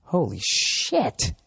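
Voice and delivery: slow, drawn-out gasp of disbelief; stunned awe. Real, not acted. Comic reacting to India's population, slow disbelief. Awed at numbers.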